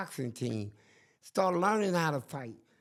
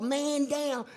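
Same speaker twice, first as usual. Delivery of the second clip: high, calling out man down; mock call for help; 7 semitones higher, slower than usual. Inmate yelling for guards, high mock alarm.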